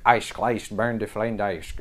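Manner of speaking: slow made-up German-sounding gibberish; fake German. Shown as a demonstration. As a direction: babble in mock German. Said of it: "if you try to speak fake German".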